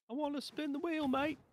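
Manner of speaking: high, hammed-up Aussie twang; terrible Australian accent. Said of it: "I've got a terrible Australian accent".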